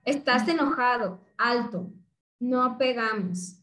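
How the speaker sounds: put-on slow, firm words to an angry child; firm slow limit. Shown as a demonstration. Parent stops an angry child, slow and firm. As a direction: set the limit calmly, word by word.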